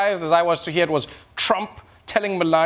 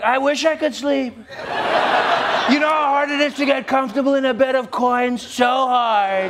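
higher, lightly whiny complaint; whiny prince; 6 semitones higher than usual. Pretend. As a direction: moan about comfort like a spoiled royal. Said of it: "He'd be like".

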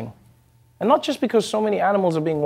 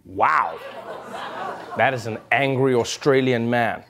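ordinary delivery

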